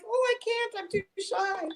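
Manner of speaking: put-on shy girl. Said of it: "And I'm like".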